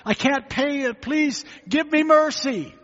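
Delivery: high, pleading, slowing at the end; begging servant. Acted. Indebted servant begs his master, high and pleading. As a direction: beg the master for mercy. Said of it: "came begging to his master".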